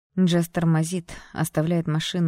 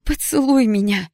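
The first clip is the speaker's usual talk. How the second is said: "Поцелуй меня!" shouted, much higher and louder; hysterical demand; 8 semitones higher, 9 dB louder than usual. A narrator's reading. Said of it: "истерично потребовала Джесс".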